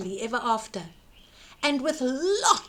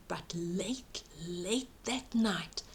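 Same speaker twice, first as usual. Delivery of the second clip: hushed whisper; 5 semitones lower, 11 dB quieter than usual. A narrator's reading. Narrator whispering to children at bedtime, hushed. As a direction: whisper it low, secret night.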